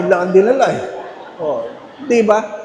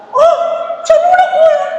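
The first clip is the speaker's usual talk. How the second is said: very high, squeaky envious question; jealous nosy guest; 3 dB louder than usual. Acted. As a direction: squeal it like a greedy eater spying a plate. Jealous guest asking seatmate.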